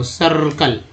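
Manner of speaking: "सर्कल" drawn out as "sarr-kal", uh in the first syllable; schwa for I. Shown as a demonstration. Teacher showing how I sounds, "सर्कल" as a drawn-out "sarr-kal". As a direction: say "सर्कल" with uh, not ee, stretching the r. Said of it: "आई का उच्चारण अ के रूप में".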